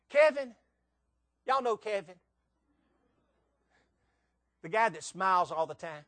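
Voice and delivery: usual speaking voice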